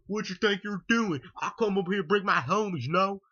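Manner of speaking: hammed-up fired-up Hank Hill voice.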